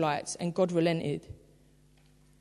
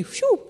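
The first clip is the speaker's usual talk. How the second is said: a high, breathy mock sigh of relief; mock relieved phew; 14 semitones higher than usual. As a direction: blow out a comic phew. Relief after joke.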